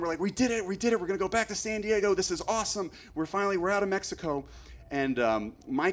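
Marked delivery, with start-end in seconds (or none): 0.2-4.4 high-pitched, excited cheering; acted-out burst of relief and triumph; retold celebration. Friends celebrating in the car after crossing the border, excited. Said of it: "like, celebrating".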